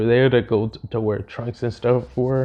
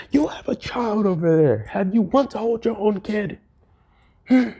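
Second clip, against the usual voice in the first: yelling; 8 semitones higher than usual. Retold or imitated. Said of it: "yelling and all this stuff".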